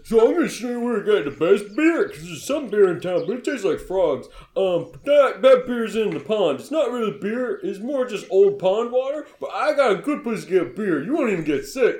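put-on high, loud, chattering child voice; hammed-up little kid character; Elmo. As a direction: ramble eagerly like an excited six-year-old. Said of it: "and he just says".

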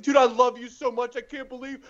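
high, loud gushing to an idol; amped-up fan. Retold or imitated. Shaking his comedy idol in a hug, amped-up gushing. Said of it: "I was so amped up".

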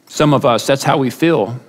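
ordinary talk, nothing marked

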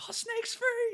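high, loud imitation of a panicked crowd; mock freak-out. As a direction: yell it like a hammed-up panicked bystander. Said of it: "everyone's freaking out".

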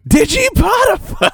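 bright squeaky sing-song welcome. Acted. Announcing show name playfully.

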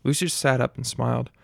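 plain reading voice

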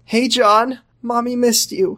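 lightly voiced mother. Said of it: "Nope, that's not the voice".

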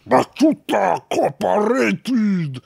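slow, high, far-off alien voice; Jabba the Hutt voice. Shown as a demonstration. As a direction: do a bad Jabba impression. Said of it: "read it in the Jabba the Hutt voice".

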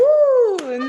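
high, loud delighted ooh. Real, not acted. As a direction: coo over the new name. Delighted by the name.

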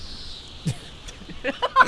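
unvoiced high giggle; high breathless laugh. Real, not acted. Laughing at the Jersey accent joke, breathless and high. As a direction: burst into airy laughter.

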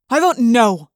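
loud, defiant refusal; firm, angry vote against; sharp, angry defiance. A narrator's reading. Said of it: "said with sharp, angry defiance".

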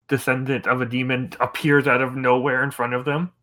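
normal talking voice

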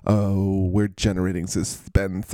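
ordinary talk, nothing marked

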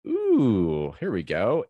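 normal talking voice

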